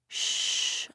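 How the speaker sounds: soft whispered shh; quiet the whole room; shushing everyone. A narrator's reading. Mother whispering shh to the whole noisy family. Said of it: "I say to everyone".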